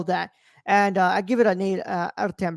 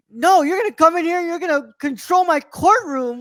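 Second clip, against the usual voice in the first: a mild mock of a furious judge, higher; angry judge; 7 semitones higher, 3 dB louder than usual. Imitating the judge scolding a witness, mock anger. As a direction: play the judge scolding, half-hearted.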